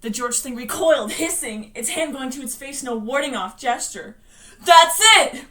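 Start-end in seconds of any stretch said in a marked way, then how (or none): 4.6-5.5 loud, high, wild cheer; delirious scream. Acted. Richie cheering Bill on, screaming deliriously. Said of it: "Richie screamed deliriously".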